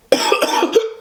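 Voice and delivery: high strained coughing fit; harsh cough. Real, not acted. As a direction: cough from the spice burn. Choking on spicy food.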